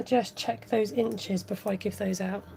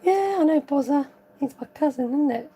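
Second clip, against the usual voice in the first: higher, hammed-up schoolboy imitation; exaggerated kid voice, pitched up; put-on child's voice; 5 semitones higher than usual. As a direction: ham up the child's reply for effect. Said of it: "doing that for effect".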